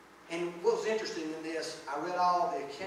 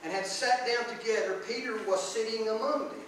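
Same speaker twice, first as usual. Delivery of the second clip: scripture reading; 4 dB louder than usual. Acted. Reads the scripture passage aloud.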